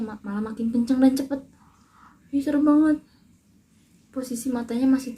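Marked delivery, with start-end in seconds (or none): 2.3-3.0 sudden high, loud cry of fright; scared shriek. Real, not acted. Recalling dad's eerie hiccups, a loud frightened cry. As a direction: blurt it out spooked and loud.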